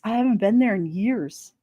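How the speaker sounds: ordinary talk, nothing marked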